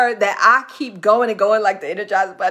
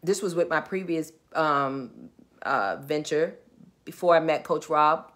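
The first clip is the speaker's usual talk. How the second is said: normal talking voice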